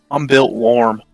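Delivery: ordinary talk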